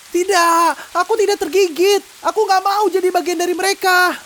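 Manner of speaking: man insisting he is not bitten; desperate denial. Acted. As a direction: deny it in panic. Bitten man denying bite.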